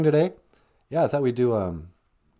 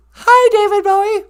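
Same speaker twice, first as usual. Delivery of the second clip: put-on very high, loud little-girl voice; squeaky girl caller; 16 dB louder than usual. Caller [name] greeting Bowie in a very high girlish voice. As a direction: chirp a hello like an excited kid. Another caller, far higher voice.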